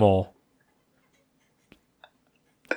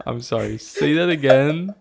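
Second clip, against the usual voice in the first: ordinary talk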